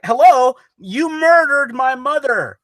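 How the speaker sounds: high, indignant retort; mock outrage. Friend voices the heroine answering her mother's killer, hammed-up outrage.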